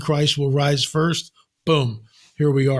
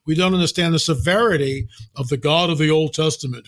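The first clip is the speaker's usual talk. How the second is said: ordinary talk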